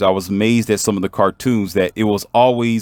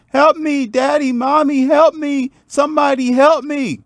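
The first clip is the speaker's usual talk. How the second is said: mock child's scream, pitched way up; imitated scream for help. Drowning boy's sister screams to her parents, high imitated scream. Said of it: "screaming at the top of her lung".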